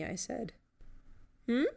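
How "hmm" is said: short rising questioning sound; curious wordless query; inquisitive hum. A narrator's reading. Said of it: "made an inquisitive sound".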